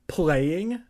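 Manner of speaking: high, loud rising question; indignant one-word query; incredulous echo. A narrator's reading. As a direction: throw the word back in disbelief. Indignant echo of Kermit's report.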